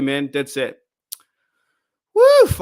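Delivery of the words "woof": loud, high woof of excitement; excited woof. Real, not acted. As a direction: let out a big woof. Fired up, exclaims.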